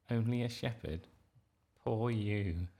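mocking laugh, fake pity; gloating mockery. A narrator's reading. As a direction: sneer at a rival with a snigger.

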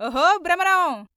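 high, loud, drawn-out call. A narrator's reading. Paramanandayya calling Bhramaram from the doorway, loud long holler. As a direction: holler the name slowly through the house. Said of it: "అని పిలిచాడు బిగ్గరగా".

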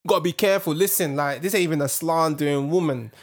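normal talking voice

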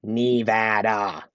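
"Nevada" drawn out slowly as nee-VAD-ah; slow, exaggerated wrong "Nevada": nee-VAD-ah; mispronounced Nevada. Shown as a demonstration. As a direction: stretch "Nevada", with "nee" first and an open "ah" last.

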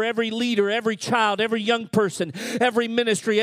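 ordinary talk

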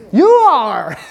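loud, high emphatic answer; emphatic shout. Real, not acted. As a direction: call it out to the whole room. Pastor answering his own question.

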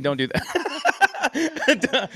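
laughing through the words. Real, not acted. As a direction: break into a laugh mid-line. Laughing joke warning.

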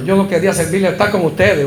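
ordinary delivery

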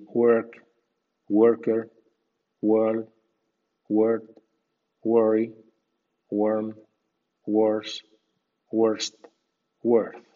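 "work", "word", "world" with an er vowel; WOR as "wer". Shown as a demonstration. Teacher models W O R before a consonant, "work" as werk. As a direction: say "worse", "worth" as wer, not wor. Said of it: "seguido de consonante suena were".

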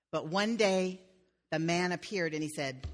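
ordinary delivery

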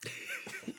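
high unvoiced laugh; quick airy laugh; breathy laugh. Real, not acted. Laugh between bites.

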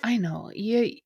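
ordinary talk, nothing marked

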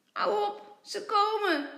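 high, loud urgent plea; urgent warning. A narrator's reading. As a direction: warn him before the parents come. Sister urgently stops crying brother.

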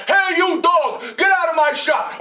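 shouting butcher; angry butcher. Acted. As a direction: shoo the dog out of your shop. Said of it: "The butcher said".

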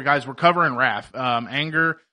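ordinary talk, nothing marked

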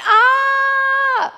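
high, loud startled ah; sudden high yelp; startled cry. Retold or imitated.

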